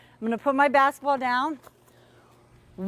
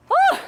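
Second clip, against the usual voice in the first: high loud breathless whew; 5 dB louder than usual. Real, not acted. Coach exhales loudly after exercising with kids. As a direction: blow out the effort after the drill. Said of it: "I'm out of breath".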